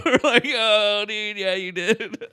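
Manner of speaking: loud, high, through laughter; laughing retold outcry. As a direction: crow it while cracking up.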